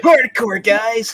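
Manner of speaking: excited cheer. Real, not acted. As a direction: whoop it to the guys. Cheering the title.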